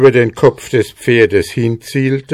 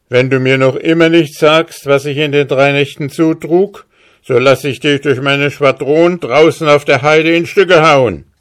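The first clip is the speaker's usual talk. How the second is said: higher, stern officer's threat; threatening captain; 6 semitones higher, 4 dB louder than usual. Acted. Captain threatens the hussar with death, stern. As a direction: menace him with the squadron.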